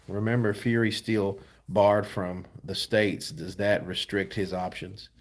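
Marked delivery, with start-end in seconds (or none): none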